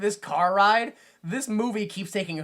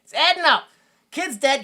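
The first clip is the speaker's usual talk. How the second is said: high, loud excited deduction; mock revelation; 7 semitones higher, 7 dB louder than usual. Host pieces together a silly theory, excited mock revelation. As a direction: announce it like a wild breakthrough.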